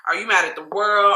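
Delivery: normal talking voice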